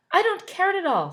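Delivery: brash, high and loud; Carrot's voice. Acted. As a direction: Carrot happily agreeing to share. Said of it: "said Carrot".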